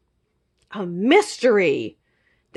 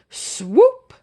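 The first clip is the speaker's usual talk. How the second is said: excited; 7 semitones higher, 3 dB louder than usual. Acted. Sliding down the tail.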